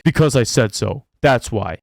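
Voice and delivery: a lightly voiced stern parent closing the argument; flat parental brush-off, just a hint of it; fed-up parent. Vlogger voicing a parent who ran out of reasons, lightly. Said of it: "And they're like".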